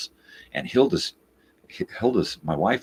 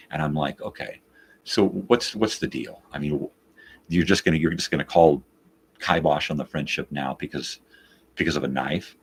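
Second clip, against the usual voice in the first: normal talking voice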